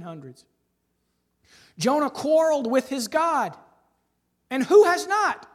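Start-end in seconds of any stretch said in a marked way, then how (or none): 1.8-5.5 ringing quote. Acted. Quoting a Puritan writer.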